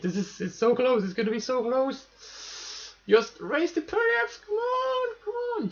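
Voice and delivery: high, strained, nearly crying; pleading with the rocket, voice climbing; tense excitement. Real, not acted. Near orbit, almost no fuel.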